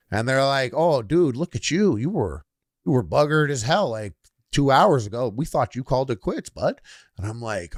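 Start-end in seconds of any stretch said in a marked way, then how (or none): 0.7-6.8 lively, raised Canadian dude voice; Canadian party person. Retold or imitated. Canadian partiers spot him two hours later, hearty Canadian accent. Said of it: "some Canadian party people".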